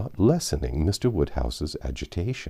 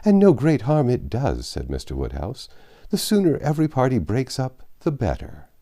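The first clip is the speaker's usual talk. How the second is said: put-on higher, fussy elderly gentleman voice; fretful old father; 8 semitones higher than usual. Anxious father worrying over Emma dining out, fretful old voice. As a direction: fret over late hours and damp air like an anxious old man. Mr Woodhouse replies.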